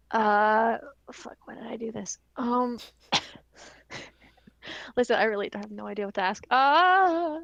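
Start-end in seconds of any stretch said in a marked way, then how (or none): 1.1-2.2 whispered to self, no voice; embarrassed whisper. Real, not acted. Player whispering to themself in embarrassment. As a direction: mutter the regret under your breath. Player panicking about asking.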